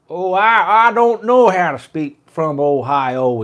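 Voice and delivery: loud, high-pitched mock Ohio accent. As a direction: belt out a hammed-up Ohio drawl. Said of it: "Speak my Ohio accent".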